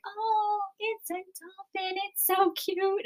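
high, soft cooing over a cute animal; cooing beachgoers. Retold or imitated. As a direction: imitate the crowd going soft and sweet. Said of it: "they're all like".

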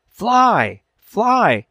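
high, loud repeated cry; urgent shouts. A narrator's reading. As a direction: yell it after the falling chick. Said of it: "they both echoed".

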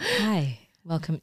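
very soft public-radio greeting; mock NPR host. Mimicking her cohost as an NPR skit host, hushed. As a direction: imitate a hushed radio welcome. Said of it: "that's what you sounded like".

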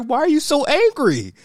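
incredulous mock question; mock baffled question. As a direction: ask like you can't believe it. Speaker's own retort.

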